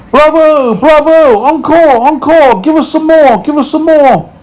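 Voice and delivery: high, loud lady spider cheering; Miss Spider shouting. A narrator's reading. Miss Spider cheering the concert, high loud shout. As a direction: call for an encore with gusto. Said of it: "Miss Spider stood up and shouted".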